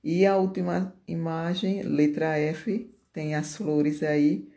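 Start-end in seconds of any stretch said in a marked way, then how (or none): none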